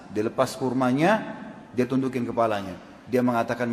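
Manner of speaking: normal talking voice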